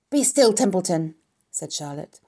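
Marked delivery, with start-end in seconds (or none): 0.1-1.1 loud, firm scolding; sharp rebuke. A narrator's reading. Charlotte silences Templeton, sternly. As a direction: cut him off firmly.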